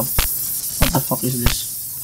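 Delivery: breathy hushed whisper. Real, not acted. Player creeping through dark rooms, whispering. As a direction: whisper it so the monster won't hear.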